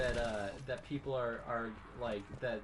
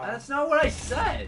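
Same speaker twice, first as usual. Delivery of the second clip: high furious yell; angry shout; 11 semitones higher, 13 dB louder, slower than usual. Real, not acted. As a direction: yell it, fed up. Heated argument.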